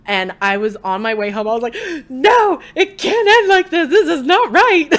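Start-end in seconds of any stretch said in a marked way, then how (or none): 2.1-4.9 loud, high-pitched retold protest, laughing; hammed-up wail of disbelief; upset mock wail. Telling friends how the ending upset her, hammed-up wail. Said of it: "I was so upset".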